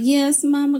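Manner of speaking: put-on little girl voice. A grown woman answering her mother like a little girl. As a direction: sound like a small daughter eager to obey. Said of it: "we always turn back into those little girls".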